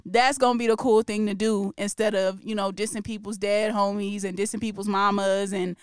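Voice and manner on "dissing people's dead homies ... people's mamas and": ordinary talk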